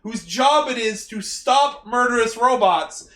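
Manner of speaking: high, loud, hammed-up outrage; mock exasperated rant. Podcaster berating the people meant to stop robots, mock exasperated. Acted rant scolding incompetent team.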